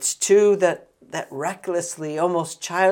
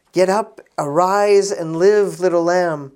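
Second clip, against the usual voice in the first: commanding call; 2 semitones higher, 10 dB louder than usual. Acted. Jesus raising the girl, preached.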